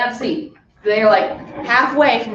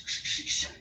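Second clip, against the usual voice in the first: soft breathy whooshing of a flying rock; fading whoosh sound effect; whispered whoosh; 17 dB quieter than usual. Retold or imitated. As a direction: breathe out the rock's flight. Rock flying through the air.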